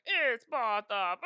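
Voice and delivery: loud, drawn-out mock British accent. Fan imitating the rapper's British alter ego, loud theatrical British accent. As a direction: belt it out in a hammy British accent. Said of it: "she would do the British accent".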